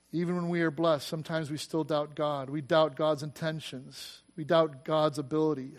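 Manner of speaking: ordinary talk, nothing marked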